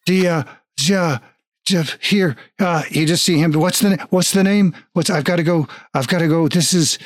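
a nervous attendant losing it; panicked clerk. Acted.

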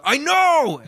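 high, exasperated shout back at the show; mock an irritated listener; impatient fan. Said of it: "impression of a fan listening".

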